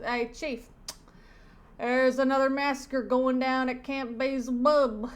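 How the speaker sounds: loud, urgent cop voice; comic-book cop. Acted. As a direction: report in loudly like a cartoon cop. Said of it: "And he says".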